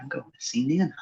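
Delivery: soft whispered excuse; sneaky whisper. Retold or imitated. Kid sneaking off to Nana's, whispered.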